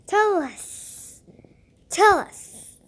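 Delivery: eager, curious serpent voice; curious snake. Acted. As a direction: press him, curious and eager. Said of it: "He sounded curious".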